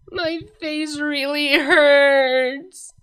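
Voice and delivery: high, slow, crying wail of pain; moaning dazed Cadance. Acted. Cadance lying dazed, wailing to Luna and Celestia. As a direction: wail it like the world is ending.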